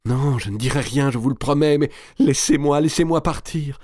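high, frantic plea, rising; desperate pleading. A narrator's reading. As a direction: beg to be let go. Marie supplie qu'on la laisse partir. (supplication affolée)

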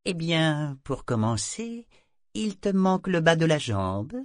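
high little voice, no compassion; pitiless. A narrator's reading. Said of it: "sans la moindre compassion".

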